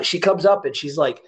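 normal talking voice

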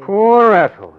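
bitter mock sympathy, high and loud; sarcastic. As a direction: sneer it back at her. Husband mocks wife's excuse.

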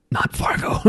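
whispered, soft refusal. Retold or imitated. Answering people who love Fargo, whispered refusal. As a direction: breathe it out like a secret veto. Her reply to Fargo fans.

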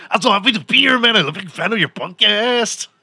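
squeaky cartoon voice like a computer reading Carlos's lines; Speedy Gonzalez voice. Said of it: "like, Speedy Gonzalez".

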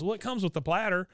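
put-on high boyish voice; Bobby excuse. Bobby excusing himself to Connie, high sheepish voice. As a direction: lift the voice up for a sheepish kid.

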